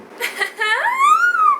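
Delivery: a long high squeal of laughter. Real, not acted. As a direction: burst into a shrieking giggle. Laughs at red minivan.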